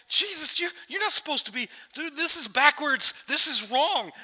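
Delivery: high-pitched, acted objection; flustered protest. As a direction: sputter it out, baffled and objecting. Peter protests, not getting it.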